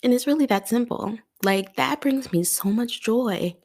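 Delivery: high, tearful and slowing; real happy tears tightening the voice upward; crying. Real, not acted. Host telling listeners what brings her joy, crying. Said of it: "I hate crying on this podcast".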